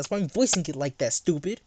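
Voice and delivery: ordinary delivery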